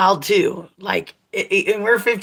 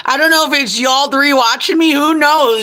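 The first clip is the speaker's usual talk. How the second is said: playful; 6 semitones higher, 6 dB louder than usual. Real, not acted. Teasing viewers about who watches.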